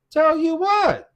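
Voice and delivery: high-pitched disbelief; retold incredulous question. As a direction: jump up high, baffled by the accusation. Retold baffled reply to angry partner.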